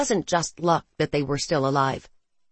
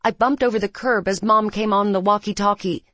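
ordinary delivery, nothing marked

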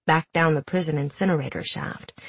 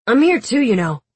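high, louder put-out whine; indignant; 7 semitones higher, 5 dB louder than usual. Acted. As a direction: complain he was overlooked. Wylan feeling overlooked.

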